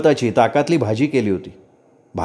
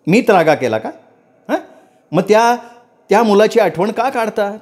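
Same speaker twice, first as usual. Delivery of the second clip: raised angry voice; 8 semitones higher than usual. A narrator's reading. Wife snapping back at husband, voice raised and angry. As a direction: defend herself, voice climbing with anger. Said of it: "प्रज्ञाचा आवाज चढलेला होता".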